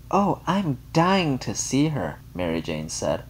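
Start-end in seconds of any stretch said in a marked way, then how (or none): 0.1-2.2 high, slow gush of excitement; breathless eager gush. A narrator's reading. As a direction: pour on the eagerness. Gushing friend eager for news.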